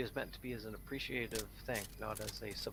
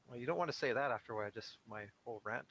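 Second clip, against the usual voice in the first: normal talking voice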